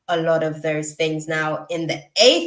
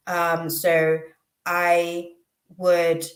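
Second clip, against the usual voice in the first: ordinary talk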